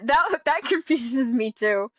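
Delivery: laughing. Real, not acted. Laughs at confusing story.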